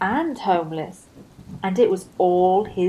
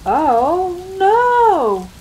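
higher, louder wail, slowing on carrots; crying out; 11 semitones higher, 6 dB louder, much slower than usual. Acted. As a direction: grief for lost carrots. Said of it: "cried Rabbit".

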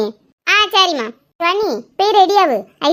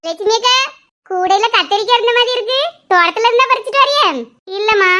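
normal talking voice

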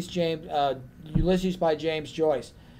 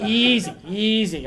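slow, soothing easy; slow down and settle him, gently; calming; 3 semitones higher, slower than usual. Retold or imitated. Calming the overeager librarian.

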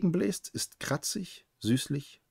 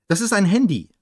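said aloud; 5 semitones higher, 7 dB louder than usual. A narrator's reading. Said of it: "sage ich laut".

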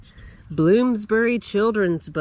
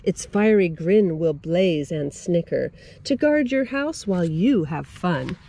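ordinary delivery, nothing marked

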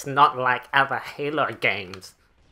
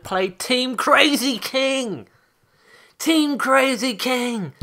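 higher, delighted shout of the mode name; excited; 10 semitones higher, 4 dB louder, much slower than usual. Real, not acted. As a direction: rave about the mode you missed. Excited about missed game mode.

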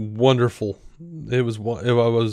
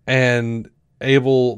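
ordinary talk

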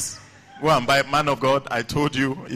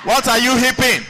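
high, loud exasperated question; mock indignant shout; 9 semitones higher, 6 dB louder than usual. Teacher rebukes cheering children, mock indignant shout.

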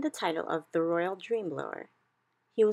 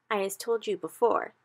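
BFG voice; 4 semitones higher, 4 dB louder than usual. A narrator's reading. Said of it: "he said".

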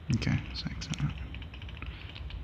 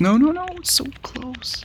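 high anxious; 14 semitones higher, 5 dB quieter than usual. Real, not acted. Near loss.

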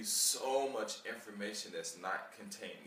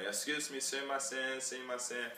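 higher, louder calling out to a passer-by; stepping in front of a stranger, calling out for attention; 6 semitones higher, 3 dB louder than usual. Retold or imitated. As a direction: call out to get their attention. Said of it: "get in front of".

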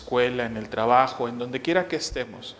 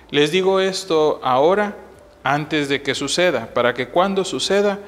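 louder, solemn proclamation of scripture; Gospel reading; 2 semitones higher, 5 dB louder than usual. Acted. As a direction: proclaim the Gospel with raised, projected voice. Reads the Gospel aloud at Mass.

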